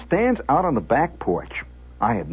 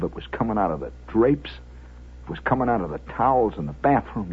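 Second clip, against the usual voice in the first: low, soft storytelling with long pauses; hushed suspense narration; 10 semitones lower, 9 dB quieter than usual. A narrator's reading. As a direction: let the aftermath hang quietly.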